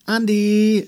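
high, loud sustained call. A narrator's reading. Mama calling Andy from the kitchen, calling out. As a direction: call a child from another room. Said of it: "rief Mama aus der Küche".

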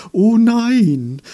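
very high, loud wail; hammed-up cry of horror; dismayed chorus. A narrator's reading.

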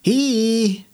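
viel höher, lauter scharfer Ruf; gerufen. Acted. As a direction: energisch rufen. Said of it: "rief sie". (Andi ruft das Wesen lauter an)

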